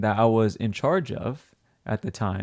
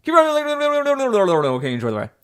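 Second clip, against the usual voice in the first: ride operator spiel; 7 dB louder than usual. Retold or imitated. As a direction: rattle it off like a bored ride operator. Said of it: "we used to be like".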